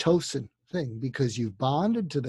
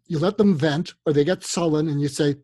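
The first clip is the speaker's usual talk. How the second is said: normal talking voice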